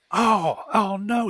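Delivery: high, loud mock "oh no" as the fall hits; sudden dismay. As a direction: act out realizing you've messed up, voice jumping up. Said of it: "you go".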